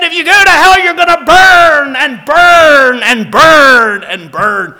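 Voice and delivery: loud, high, slow stretched shout on burn; furious yelling. Retold or imitated. Mick shouting at the pastor about hell, loud slow furious yell. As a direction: drag out every burn in fury. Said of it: "furious and yelling".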